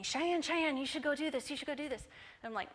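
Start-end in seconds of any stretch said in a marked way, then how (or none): 0.0-1.5 sing-song teasing, pitched higher; teasing teammates. Retold or imitated. Teammates egging Cheyenne on, sing-song teasing. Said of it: "they're teasing me". 1.5-2.0 softer sing-song tease, still higher; teasing repeat. Retold or imitated. Teammates nudging Cheyenne toward the ministry, fading sing-song tease. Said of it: "they're teasing me".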